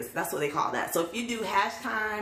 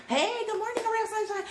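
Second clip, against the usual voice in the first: much higher sing-song chirpy hello; therapy leader greeting. Retold or imitated. Imitating her therapy leader's much higher sunny morning greeting. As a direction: chirp it brightly like a cheery group leader. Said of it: "group therapy leader would say that".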